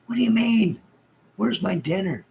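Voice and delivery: high, loud squeal of outrage; mock indignant. To the hospital staff, demanding his dinner, high mock outrage. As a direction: play the spoiled patient, shrieking.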